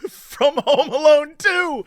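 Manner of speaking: high, slow, laughing mock delight; teasing a friend's movie knowledge, mock excited; mock excited recognition. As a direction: gush it like a proud kid. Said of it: "And you go".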